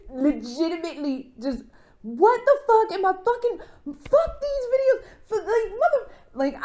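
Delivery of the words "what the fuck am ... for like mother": furious outburst. Retold or imitated. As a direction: blow up at the videos, pitch climbing. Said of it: "I was mad".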